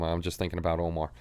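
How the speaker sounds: normal talking voice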